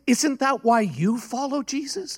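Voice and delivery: high-pitched, searching retold question; earnest challenge.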